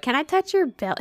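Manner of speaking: high, pleading little request; imitated nosy stranger. Stranger to a pregnant person, high imitated request. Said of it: "They're like".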